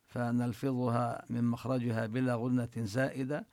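ordinary talk, nothing marked

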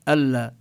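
"ألا" with a stressed double lam; doubled lam. Shown as a demonstration. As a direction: lean on the lam twice. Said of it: "نشدد اللام". (تشديد اللام في "ألا")

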